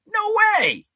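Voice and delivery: very high, loud astonished cry; amazed disbelief. Real, not acted. Host hearing a viewer ate wings with Night Ranger, amazed. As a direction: exclaim it in delighted disbelief.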